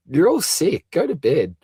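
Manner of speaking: loud, high, playful telling-off; mock scolding. Host packing late-night viewers off to bed, mock scolding. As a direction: call it out in mock outrage.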